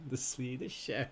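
a soft, mumbled hint of a mock Swedish accent on "The Swedish chef"; half-hearted Swedish chef accent. Trivia host quietly tries a Swedish chef accent after the answer. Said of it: "Can you do an accent?".

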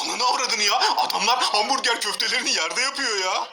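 loud, appalled yelling; shocked shouting. Real, not acted. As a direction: yell out in disgust at the footage. Shocked reaction to the dirty kitchen.

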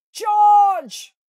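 very high, very loud yell; battle holler. A narrator's reading. Badger bursts into the kitchen with a very high, very loud battle yell. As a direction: bellow the charge. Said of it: "hollered Badger".

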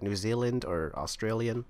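normal talking voice

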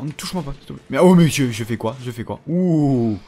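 ordinary talk

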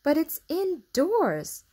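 drawn-out high protest; insisting. A narrator's reading. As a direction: dig in and insist. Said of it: "insisted Mary".

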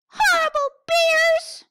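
high, loud furious "Horrible bear!"; angry shout. Acted. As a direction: yell it in a tantrum.

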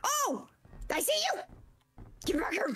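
high gleeful cry; monster's high triumphant shriek. Pretend. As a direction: pounce with delight. Monster spots friend, shrieks.

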